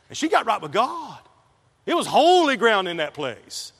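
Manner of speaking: loud, high, fervent preacher voice; preaching at full force. Acted. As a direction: preach it like the story is happening now. Preaches the conversion story loudly.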